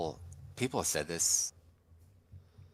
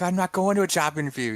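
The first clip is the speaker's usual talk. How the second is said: high-pitched retold protest; mock argument yell; 10 semitones higher, 4 dB louder than usual. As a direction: imitate a driver arguing back, exasperated. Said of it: "they start yelling back and forth".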